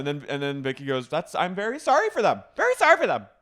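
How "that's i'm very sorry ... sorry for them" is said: high, raised-voice imitation; mock defensive retort. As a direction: push the apology high and loud, escalating on the repeat. Acting out Vicky's argued reply.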